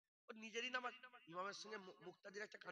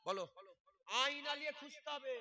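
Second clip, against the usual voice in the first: ordinary delivery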